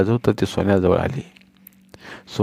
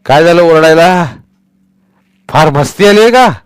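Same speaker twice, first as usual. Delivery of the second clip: angry shout; 8 semitones higher, 9 dB louder than usual. Acted. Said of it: "रागारागात".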